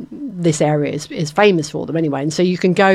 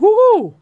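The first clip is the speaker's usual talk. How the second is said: mock hot-mouth woo; 8 dB louder than usual. Real, not acted. Spicy sambal heat exclamation.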